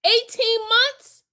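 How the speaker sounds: very high, slow incredulous question; outraged disbelief. Real, not acted. Hearing the wife's light sentence, slow high incredulous outrage. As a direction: stretch it out in shock.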